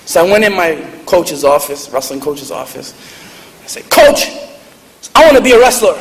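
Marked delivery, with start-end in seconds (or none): 3.9-6.0 put-on high, loud kid announcing himself; eager kid. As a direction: call out boldly as a small boy to his coach.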